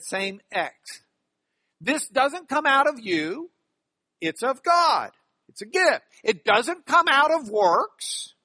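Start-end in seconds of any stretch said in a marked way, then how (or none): 1.8-8.3 high, loud, hammering "God" against "works"; emphatic contrast. Shown as a demonstration. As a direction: drive home each phrase, punching "God" and "works". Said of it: "he makes that emphasis".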